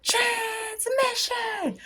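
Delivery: high, slow, drawn-out incantation; a drawn-out high chant of the word; dramatic call. Shown as a demonstration. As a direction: call it out like a spell, stretched long. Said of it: "let me do it one more time".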